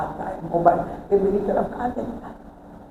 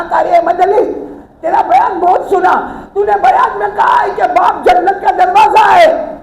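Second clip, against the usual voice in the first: much higher and louder, crying out the preacher's name; anguished shout; 11 semitones higher, 10 dB louder than usual. Retold or imitated. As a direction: cry out to the preacher in despair. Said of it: "पकड़ने के बाद कहा".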